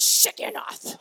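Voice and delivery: Shigionoth hissed out like a curse word; unvoiced mock cuss, Shigionoth; mock swearing in church. Shown as a demonstration. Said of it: "like you pretend you're swearing in church".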